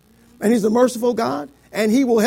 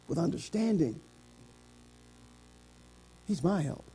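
soft, low pulpit reflection with long pauses; hushed preaching; 3 semitones lower, 6 dB quieter than usual. Real, not acted. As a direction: let each phrase settle quietly. Hushed close of sermon.

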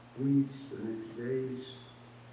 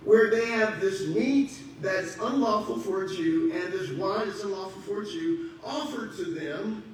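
loud, slow preaching build; full-force pulpit preaching; 7 semitones higher, 10 dB louder, slower than usual. Acted. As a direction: preach it out with fire.

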